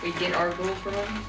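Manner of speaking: ordinary talk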